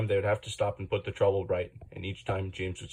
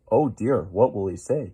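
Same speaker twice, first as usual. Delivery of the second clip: sad; 7 dB louder than usual. A narrator's reading. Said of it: "he asked himself sadly".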